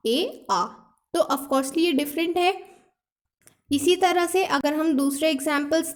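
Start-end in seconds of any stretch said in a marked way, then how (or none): 0.1-0.6 'ए' then 'आ', short a against open aa; contrasting vowels. Shown as a demonstration. Teacher contrasting two sounds of letter A, deliberately. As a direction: set 'ए' and 'आ' side by side. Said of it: "एक बार pronounce किया ए और एक बार किया आ".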